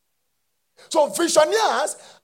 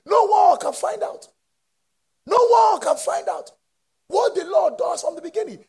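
high, loud preaching cry; shouted preaching; 10 semitones higher, 5 dB louder than usual. Acted. Preacher crying out the verse to the church. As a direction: cry the words out.

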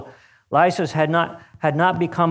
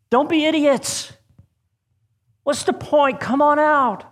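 much higher shout into the night; 11 semitones higher than usual. Acted. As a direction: yell at them across the pond, high. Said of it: "the guards shouted into the night".

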